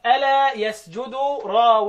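very slow, drawn-out recital of the line; slow verse recital. Shown as a demonstration. Teacher reciting the poem line slowly to his students. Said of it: "قال لك".

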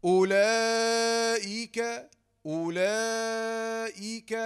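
slow, stretched أولئك with nasal madd; teacher stretching أولئك long and slow; drawn-out ulaik. Shown as a demonstration. Teacher drills the long vowel, stretched slowly. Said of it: "وتقول".

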